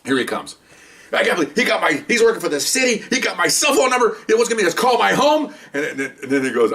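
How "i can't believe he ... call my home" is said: high-pitched retold rant of disbelief; indignant rant. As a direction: rattle it off as the outraged colleague. Colleague ranting after the call.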